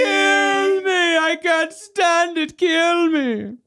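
high, slow, melodramatic shrieking plea; over-the-top anguished wail; hammed-up shriek. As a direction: shriek like a heartbroken vampire. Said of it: "He shrieked for Stephen to kill me".

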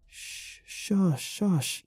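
calm the frightened hens; soothing shush. Acted. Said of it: "I cooed".

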